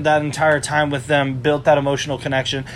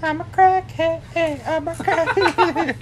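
silly sing-song; much slower than usual. Pretend. Silly chant while cat plays.

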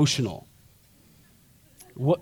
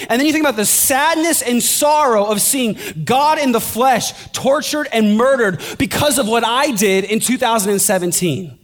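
rising to a higher, louder sermon pitch; impassioned preaching; 7 semitones higher, 3 dB louder than usual. Acted. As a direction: preach the cross, building intensity. Pastor preaching at full force.

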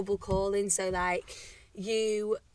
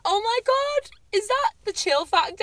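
mock amazement; 10 semitones higher, 5 dB louder than usual. Mock excited quote of themselves.

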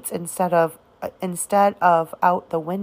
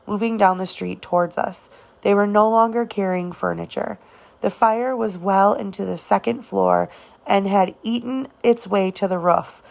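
usual speaking voice

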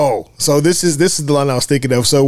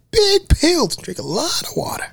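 Roger's voice. Retold or imitated. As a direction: chirp it up high like Roger. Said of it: "the way Roger begins to describe it".

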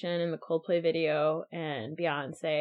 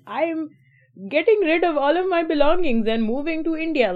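raised pitch, hammed-up Indian accent; mock Indian accent; 8 semitones higher, 6 dB louder than usual. Mocking a sitcom character's Indian accent to a friend. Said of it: "He starts to talk in an accent".

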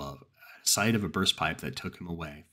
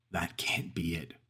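whisper; 3 dB quieter, slower than usual. Acted. Shocked reaction to footage.

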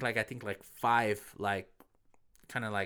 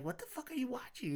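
mocking wife's voice; 13 semitones higher, 9 dB quieter, faster than usual. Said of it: "you're like".

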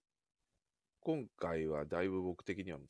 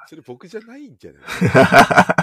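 laughing; 9 semitones higher, 28 dB louder than usual. Real, not acted. Laughing protest at teasing.

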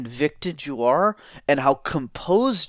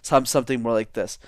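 normal talking voice

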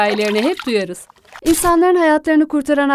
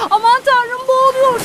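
high-pitched panicked exclamation; sudden cry of alarm; 12 semitones higher, 3 dB louder than usual. A narrator's reading. Narrator voices alarm at drowning child.

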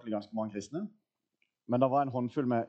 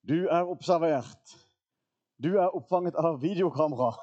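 high, loud security loudspeaker warning; imitated loud surveillance announcement; 6 semitones higher, 5 dB louder than usual. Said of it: "så kommer det en sånn høy stemme".

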